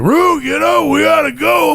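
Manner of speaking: high shouted nagging call; mimicking friend's wake-up call. Friend shouting at sleepy Rue to get going, high mock yell.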